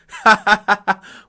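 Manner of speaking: high loud wail; anguished wail. Acted. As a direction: wail in grief. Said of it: "gào khóc".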